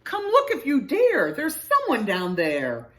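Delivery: loud call, starting high and dropping. A narrator's reading. Badger beckons friends to the dark hole, calling out. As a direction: call friends over to look.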